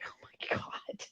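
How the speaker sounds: breathless whispered disbelief, very soft; stunned whisper. Retold or imitated. Retelling her reaction to her dad, whispered in shock.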